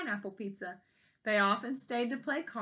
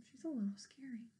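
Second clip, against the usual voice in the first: quick whispered aside; 11 dB quieter, faster than usual. Real, not acted. Reader telling the children a secret, whispering. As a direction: lean in and whisper it. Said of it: "whispered aside to children".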